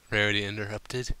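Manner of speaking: usual speaking voice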